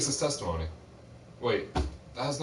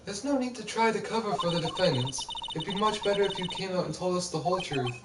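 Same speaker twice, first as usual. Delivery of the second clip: lawyer character; 8 semitones higher than usual. Acted. Reads lawyer's lines.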